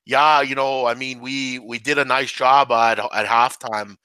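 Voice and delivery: slow, drawn-out Canadian hockey-player voice; hammed-up Canadian interview drawl; Canadian accent. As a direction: put on a bad Canadian accent, dragging it out. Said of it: "I have to talk like this".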